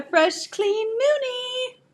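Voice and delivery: rising high, drawn-out singsong. Real, not acted. Showing off the freshly changed baby.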